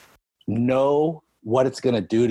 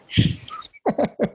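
breathy laugh. Real, not acted. Laughing at coach's trick.

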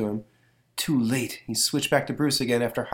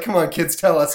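pleading editors voice; 7 semitones higher, 8 dB louder than usual. Retold or imitated. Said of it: "They're like".

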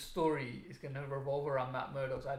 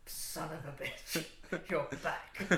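mock disbelief; 5 dB quieter, much slower than usual. Answers the acted plot twist.

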